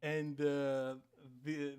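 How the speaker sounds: ordinary talk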